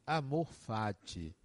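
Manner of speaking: ordinary talk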